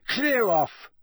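high, sharp angry dismissal; snapping. A narrator's reading. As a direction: snap at onlookers to go away. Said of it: "Ron snapped at them".

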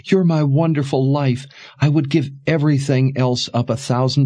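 high, impassioned, tearful mother; weeping reassurance. A narrator's reading. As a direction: console a child fervently through tears, pitched high. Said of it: "as she wept".

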